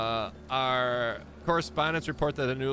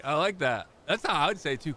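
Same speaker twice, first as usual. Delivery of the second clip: usual speaking voice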